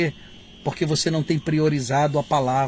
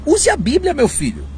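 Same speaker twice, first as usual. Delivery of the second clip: much higher pleading "use a Bíblia"; urging advice; 10 semitones higher than usual. Shown as a demonstration. Preacher urging prophets to simply cite the Bible. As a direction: plead with them, voice way up. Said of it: "Olha, diga o seguinte".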